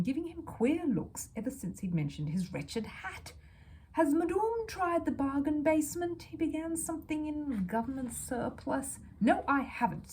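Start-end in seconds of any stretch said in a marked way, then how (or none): 3.9-9.0 mock-posh salesman's sneer. Salesman sneers at Mrs Brown. 9.2-10.1 a touch of indignation, louder; mildly heated reply; hot. A narrator's reading. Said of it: "said Missus Brown hotly".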